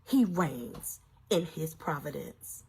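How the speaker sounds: hushed blessing. Real, not acted. Quiet sermon close.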